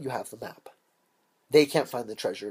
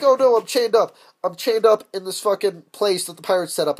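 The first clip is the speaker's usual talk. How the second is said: louder, higher hammed-up complaint; mock panic; 6 semitones higher, 6 dB louder than usual. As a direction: pretend captive in distress. Hero acted, captured and chained.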